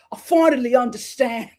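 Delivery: cheeky British imitation breaking into laughter; little British accent. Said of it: "my little British accent".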